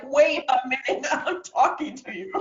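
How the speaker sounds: high mock protest breaking into a laugh; exasperated presenter. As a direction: call after students who left, laughing. Acted teacher calling after vanished students.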